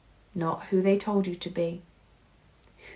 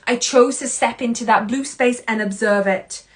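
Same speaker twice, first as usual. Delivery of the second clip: usual speaking voice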